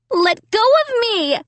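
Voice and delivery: high, panicked cry; frightened cry. A narrator's reading. Amy struggling in the dummy's grip, a panicked cry. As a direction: shout it in panic. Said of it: "I cried".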